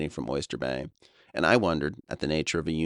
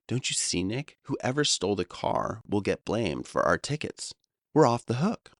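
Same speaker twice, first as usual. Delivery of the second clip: hammed-up triumphant friend; excited; 7 semitones higher than usual. Friend revealing they escaped blame, rising excitement.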